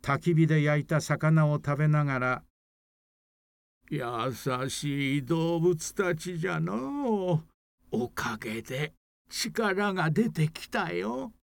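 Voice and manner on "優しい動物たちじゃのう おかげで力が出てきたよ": put-on slow, pleased old man voice; a kindly old grandpa, slowly; creaky wise old man. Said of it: "嬉しそうに話しました".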